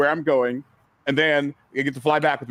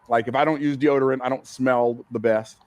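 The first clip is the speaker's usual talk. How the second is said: ordinary talk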